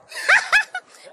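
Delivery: high shrieking witch cackle; witch laugh. Shown as a demonstration. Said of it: "you can use the witch laugh".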